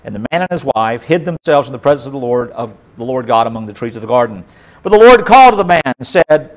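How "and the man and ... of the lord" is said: ordinary talk, nothing marked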